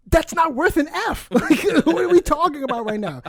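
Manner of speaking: high-pitched incredulous objection; mock-outraged, pitch way up; retold indignant protest. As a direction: protest like a wronged kid, half laughing. Retold argument with mom.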